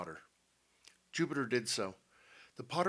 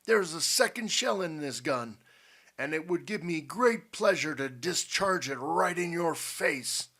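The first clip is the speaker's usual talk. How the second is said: loud, raised, emphatic threat; menacing Potter; 6 semitones higher, 6 dB louder than usual. A narrator's reading. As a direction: threaten him with relish and authority.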